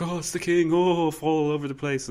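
high, mock gushing subjects; fawning courtiers. Courtiers fawning over their king, hammed-up gush. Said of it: "people were like".

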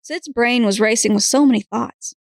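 usual speaking voice

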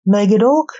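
tentative question with rising end; mildly uncertain, questioning lift; unsure. A narrator's reading. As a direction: ask it as if doubting who this is. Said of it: "not sure it was her father".

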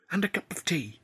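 high, breathless whispered admission. Acted. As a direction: let it slip under your breath. Tilly's reluctant whispered admission.

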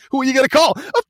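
high, loud, fast furious retort; hammed-up angry gangster yell; exasperated yell. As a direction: yell it, fed up with the question. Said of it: "Chris Penn is like".

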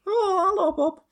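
very high-pitched comic Wolverine. Acted. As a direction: play Wolverine as a tiny squeaky bloke. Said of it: "You didn't even say the word bub".

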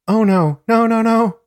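high, loud cry of protest; acted scream. A narrator's reading. Narrator voices victim under attack, acted high scream.

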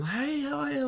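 very high, bright mock-friendly hello; cheery greeting. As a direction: chirp it up high like a naive newcomer. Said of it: "like that".

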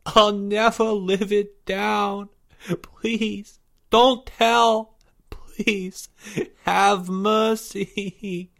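high, slow, drawn-out wail; blubbering begging. A narrator's reading. Shamed boy begging classmates not to tell, blubbering. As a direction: plead tearfully, stretching every word. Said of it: "begged and blubbered uncontrollably".

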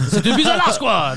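a laughing, high, loud Quebec French "C'est une pizza large"; Quebec accent. Retold or imitated. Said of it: "Quebecers don't say large".

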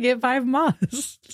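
soft, slow, resigned royal sigh; Princess Diana impression. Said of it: "was like".

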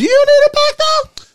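squeaky high question. Acted. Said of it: "I mean".

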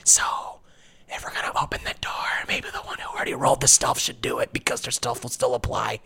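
hushed whispered plan. Pretend. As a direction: whisper it so no one hears. [name] whispering plan at door.

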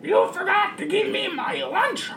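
squawking, complaining parrot; Pickles. Acted. Parrot scolds captain over forgotten lunch, squawking. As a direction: screech it like a hungry pet bird. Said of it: "Pickles the parrot".

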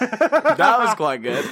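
slow, laughing high praise. Real, not acted. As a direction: giggle through the words. Laughing at the impression.

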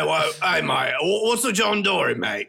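hammed-up Aussie greeting; Australian accent. Host practising slang on his mates, hammed-up Australian accent. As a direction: put on a broad Australian mate accent. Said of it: "in Australian accents".

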